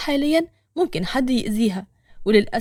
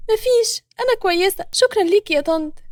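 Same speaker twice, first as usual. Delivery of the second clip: put-on high, slightly raised young woman's voice; 8 semitones higher than usual. A narrator's reading. Nour reassures a kind stranger, high young woman's voice. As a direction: lift the pitch high for a polite young woman.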